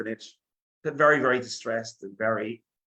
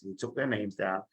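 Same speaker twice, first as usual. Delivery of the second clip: ordinary delivery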